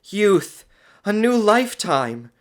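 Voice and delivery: high, loud rapture; excited. A narrator's reading. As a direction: exult in being young. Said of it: "She grew excited".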